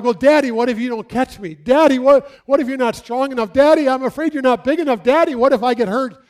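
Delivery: put-on high, loud pleading child voice; scared child. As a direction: whimper like a frightened little kid begging daddy. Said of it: "And they're like".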